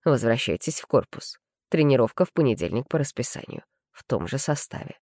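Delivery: low, cold curator voice; a hint of a stern curator. A narrator's reading. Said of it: "холодно произнёс".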